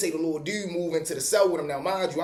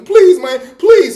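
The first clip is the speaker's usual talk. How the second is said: loud, high whiny plea for mercy; hammed-up pleading from the floor; mock begging; 13 semitones higher, 14 dB louder, slower than usual. The beaten cellmate begging the uncle, mock loud pleading. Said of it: "all that tough Tony shit go out".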